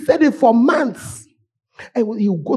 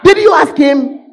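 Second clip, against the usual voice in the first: loud, high demand; sharp shouted challenge; shouted question; 7 semitones higher, 8 dB louder than usual. Real, not acted. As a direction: hurl the question at them.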